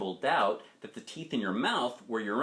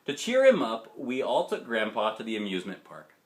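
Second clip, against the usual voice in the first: ordinary delivery, nothing marked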